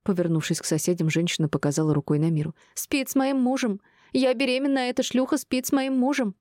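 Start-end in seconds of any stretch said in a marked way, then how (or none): 2.7-6.3 high-pitched, aggrieved public accusation; denouncing. A narrator's reading. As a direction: appeal to onlookers in high outrage. Wife denounces rival before neighbours.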